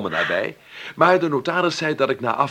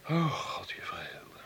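breathless whisper of wonder; awed whisper; 9 semitones higher, 11 dB quieter, slower than usual. Acted. Heir whispering in awe about his new freedom. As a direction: whisper it overcome with joy.

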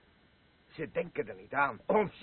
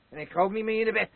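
fast, loud and higher, spat out; defiant shout; 7 semitones higher, 10 dB louder, much faster than usual. Acted. Drunk old man snapping at his wife, fast furious shout. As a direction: hurl the refusal at her, fast and loud.

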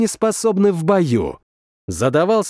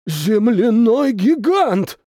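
alarmed cry; 6 semitones higher, slower than usual. Acted. Onlooker cries out in alarm.

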